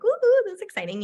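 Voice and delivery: high bright woo-hoo burst; squealing with excitement; excited cheer. Retold or imitated. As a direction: cheer it out, thrilled. Excited retold cheer at conference.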